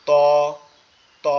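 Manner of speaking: ত said with tongue tip on the gums behind the front teeth; teacher demonstrating ত clearly; Arabic letter ta. Shown as a demonstration. Teacher shows students how to say the letter ত. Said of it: "এভাবে".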